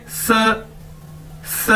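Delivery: स with the teeth slightly open, then closed; Chinese si sound. Shown as a demonstration. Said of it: "पीछे से थोड़े से दांत खोल के".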